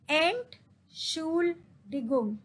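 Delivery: Entschuldigung in slow, separate pieces, ent, shool, digung; slow pronunciation model. Shown as a demonstration. Teacher drills the pronunciation, Entschuldigung slowly in pieces. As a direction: sound out Entschuldigung part by part. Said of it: "I will just tell you the pronunciation".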